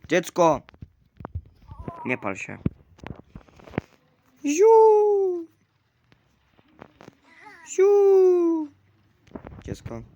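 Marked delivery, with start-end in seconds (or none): 0.1-0.6 calling out. Real, not acted. Calling the child loudly. 4.4-8.7 squeaky mock "ziu" whoosh, much higher and louder; high-pitched whoosh. Mock sound effect, very high.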